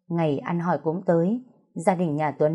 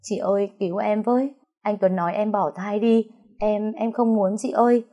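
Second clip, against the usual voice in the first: higher, pleading, close to tears; tearful plea for help; 4 semitones higher than usual. Acted. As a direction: beg your sister to save you. Sister cries for help on phone. (van xin trong nước mắt, giọng cao)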